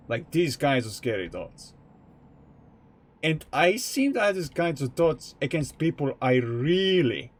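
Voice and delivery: normal talking voice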